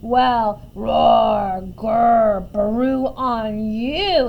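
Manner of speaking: loud, mocking giant; big storybook giant taunting with animal noises; giant's yell. A narrator's reading. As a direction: yell it slowly, drawing out each noise. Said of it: "he yelled".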